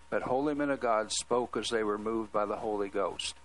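ordinary delivery

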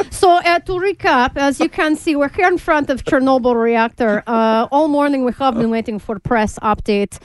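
put-on stalling live reporter in broken Russian-accented English. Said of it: "and they're like".

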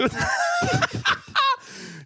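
high-pitched burst of laughter; real helpless laugh, shooting up high. Real, not acted. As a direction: crack up at the joke. Reacts to joke about swearing.

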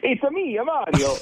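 put-on a quick light Mario voice in Italian accent; Mario voice on request; Mario impression. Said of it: "let me hear the Italian accent".

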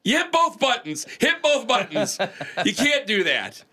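high voice fighting back laughter; stifled giggles. Real, not acted. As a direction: try not to crack up. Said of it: "I'm trying not to laugh".

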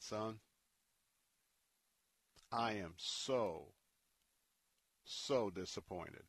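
soft, slow, heavy-hearted father's voice; a quiet, grieved father speaking to his son; disappointed father. Retold or imitated. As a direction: let the disappointment sink in slowly. Dad's quiet rebuke in story.

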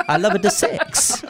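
laughing mock Latin lover accent; Italian lover voice. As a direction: purr it like a hammed-up Latin lover. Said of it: "Like I'm some sort of Italian lover".

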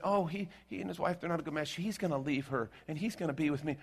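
a hint of a naive girl defending her lover; naive girlfriend. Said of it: "This poor girl tell me".

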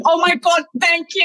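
high and loud, overjoyed thanks; relieved excitement. Retold or imitated. Contestant retelling a rare correct guess, excited relieved squeal. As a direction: squeal it like you finally got one right. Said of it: "I'd be like".

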